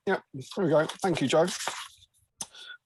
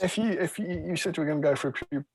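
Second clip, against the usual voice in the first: normal talking voice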